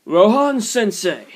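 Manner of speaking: yelled. A narrator's reading. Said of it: "turned towards the house and yelled".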